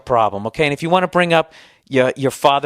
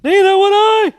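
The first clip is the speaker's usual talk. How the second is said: much higher and louder squealing mockery; mock high whine; 6 dB louder than usual. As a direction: redo it even higher, hamming up the mockery. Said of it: "Why did my voice go up so high?".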